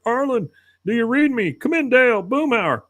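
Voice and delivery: high, strained Bill voice on the walkie; Bill over the radio. Retold or imitated. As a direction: call out as a lost, desperate Bill.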